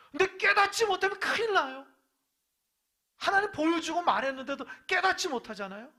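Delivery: high, loud shouted sermon; fiery preaching. Acted. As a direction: preach it at full force. Preaching at full force.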